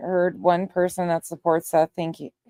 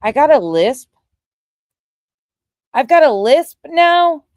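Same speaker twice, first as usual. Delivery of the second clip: loud and high, outraged by the claim; indignant disbelief; 8 semitones higher, 9 dB louder than usual. Real, not acted. Co-host hearing she has a lisp, loud indignant disbelief. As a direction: throw the question back, higher and louder, affronted.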